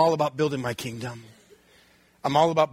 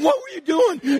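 scolding dad; 12 semitones higher than usual. Retold or imitated. Dad scolding nine-year-old outside, raised voice. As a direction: tear into the boy.

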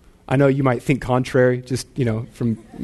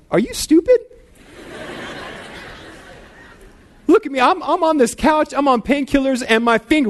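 mock angry wife; 10 semitones higher than usual. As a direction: play the annoyed wife, sarcastic and shrill-high. Imagined angry wife in story.

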